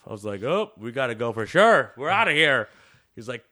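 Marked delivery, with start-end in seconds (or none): 0.4-2.7 loud, urgent we gotta go; hammed-up bolt for the door; mock panicked shout. Retelling panicked exit.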